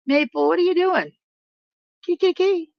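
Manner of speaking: high, sing-out pet-calling voice. Real, not acted. Streamer breaks off to Maple the pet, high-pitched calling.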